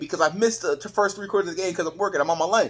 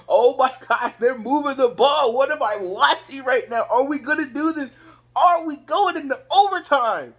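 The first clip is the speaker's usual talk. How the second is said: higher-pitched, worked-up fan; getting excited; 5 semitones higher than usual. Retold or imitated. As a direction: build up in amazed hope. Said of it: "I'm getting excited".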